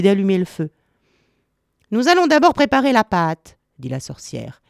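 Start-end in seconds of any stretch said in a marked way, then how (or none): 1.9-3.6 lightly voiced witch, pitched high; bossy witch. A narrator's reading. Witch setting Gretel to work by the oven, bossy. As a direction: announce it briskly in a higher voice.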